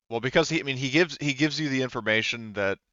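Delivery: ordinary talk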